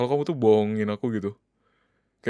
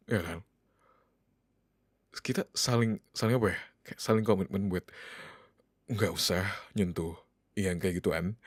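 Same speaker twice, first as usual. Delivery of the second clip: much softer, lower, disappointed; hurt and quiet; 3 semitones lower, 4 dB quieter than usual. Acted. Husband telling his wife how let down he feels, softly hurt.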